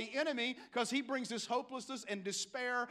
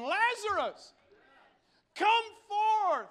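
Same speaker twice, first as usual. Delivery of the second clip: loud, high preacher's summons; calling out; 10 semitones higher, 8 dB louder, slower than usual. Acted. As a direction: call one man out by name. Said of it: "My Bible says loud voice".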